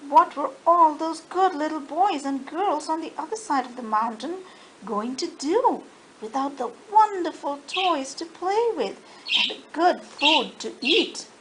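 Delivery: usual speaking voice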